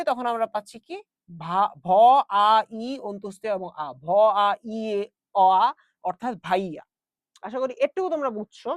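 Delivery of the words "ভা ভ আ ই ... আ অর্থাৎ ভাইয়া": ভ, আ, ই split apart sound by sound; sounding out phonemes. Shown as a demonstration. Teacher breaks ভাইয়া into its separate sounds. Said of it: "যখন আমরা উচ্চারণ করি".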